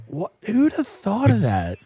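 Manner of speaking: mock bafflement. Co-host recalling reaction to torture stories, mock bafflement. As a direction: act out baffled disbelief, drawn out.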